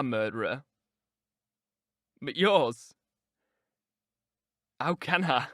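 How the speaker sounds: low, tormented lover's voice; anguished man. Acted. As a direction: speak low and heavy with grief.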